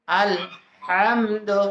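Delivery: "আলহামদু" very slowly, with a breathy throat h; throat ha drill. Shown as a demonstration. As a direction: split "আলহামদু" after আল, then draw out হাম from mid-throat. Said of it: "বেশি চাপ দিলে আবার উচ্চারণটা হবে না". (টেনে টেনে, গলার মাঝখান থেকে হা দিয়ে "আলহামদু")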